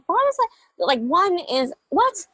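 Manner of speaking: squeaky high disbelieving what; baffled pitch jump, can't believe it; high-pitched stunned what; incredulous. Retold or imitated. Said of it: "I'm like".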